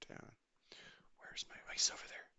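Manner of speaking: hushed whisper, sneaking up on a fish. Real, not acted. Streamer creeping toward a fish, whispering. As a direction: breathe the words out so nothing hears you. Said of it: "Why am I whispering?".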